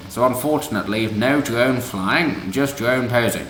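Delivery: a silly put-on Mog accent read of the activist's words; mock Mog accent. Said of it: "Let's go with Mog, why not?".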